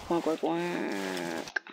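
mock duck quacks, slow and soft; slow quacking. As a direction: stretch each quack out like a lazy duck. Quacking as the penguin.

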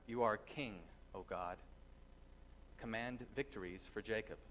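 ordinary delivery

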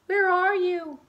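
put-on tiny, high, loud baby-bat squeak. Lost baby bat calling for her mother, high squeak. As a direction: call out like a lost little bat. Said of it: "Stella Luna squeaked".

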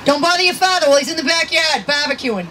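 hammed-up Boston accent. Shown as a demonstration. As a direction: show off a broad Boston accent. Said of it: "working on my Boston accent".

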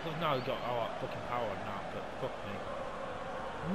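low, soft, slow grumble; despairing mutter. Real, not acted. Gamer deflated after the chance, low mutter. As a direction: mutter it under your breath in defeat.

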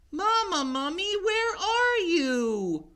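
put-on high, slow calling child; calling for mama. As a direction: call out lost in the dark. Lost baby mummy calling.

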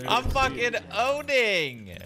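slow, high triumphant yell; gloating shout; excited bragging. Real, not acted. Bragging after kills.

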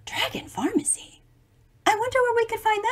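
high puzzled whisper; whispered wonder. Real, not acted. Reading to children, whispering a puzzled question. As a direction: breathe it out in amazed disbelief.

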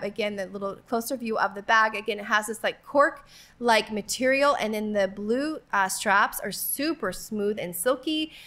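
ordinary delivery

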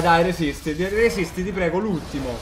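usual speaking voice

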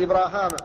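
إبراهام said with a long a after the h, ibraahaam; Hisham's reading. Retold or imitated. Teacher quoting Hisham's reading, إبراهام with the long a after h. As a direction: give Hisham's variant إبراهام with the alif. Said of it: "قرأه هشام عن ابن عامر بالألف".

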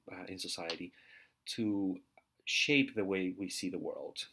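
usual speaking voice

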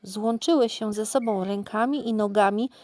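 usual speaking voice

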